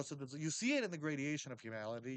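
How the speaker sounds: ordinary talk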